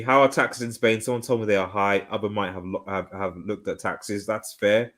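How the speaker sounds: ordinary talk, nothing marked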